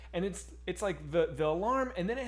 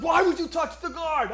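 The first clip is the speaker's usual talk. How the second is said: higher, loud shouted tough-guy rant; angry Brooklyn manager; 11 semitones higher, 7 dB louder than usual. Retold or imitated. Manager scolding him before the restaurant, loud tough-guy shout. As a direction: yell at the diner like a furious Brooklyn boss. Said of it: "He's like".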